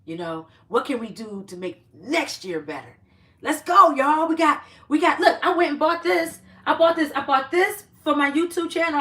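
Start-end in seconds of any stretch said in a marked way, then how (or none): 3.4-9.0 excited. Real, not acted. Showing new purchase to viewers.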